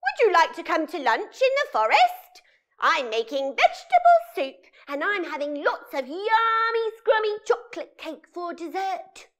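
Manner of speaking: loud cackling witch voice inviting to lunch; a cheery witch cackles and invites them over; witch on the phone. Acted. Witch calls the children to lunch, cackling and loud.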